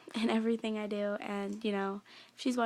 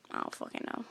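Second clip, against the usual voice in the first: whispered aside; 7 dB quieter, faster than usual. Real, not acted. Frustrated aside about no boyfriend.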